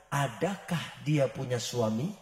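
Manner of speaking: soft, low, slow question; calm question. Retold or imitated. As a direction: ask gently and calmly. Prophet asks calmly.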